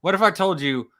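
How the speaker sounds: ordinary delivery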